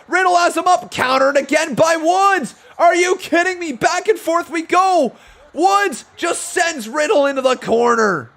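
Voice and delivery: riding every counter with rising hype; excited play-by-play. Real, not acted. Commentator calling a back-and-forth counter sequence, excited shouting.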